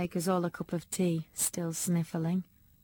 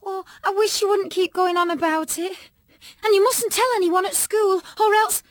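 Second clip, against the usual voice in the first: frantic pleading; 12 semitones higher, 8 dB louder than usual. A narrator's reading.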